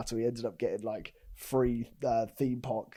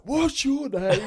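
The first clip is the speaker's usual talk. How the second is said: sharp raised demand, pitched way up; dad losing it at the staff; angry demand; 12 semitones higher, 6 dB louder, much slower than usual. Retold or imitated. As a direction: confront him, high and loud. Dad confronting staff in queue.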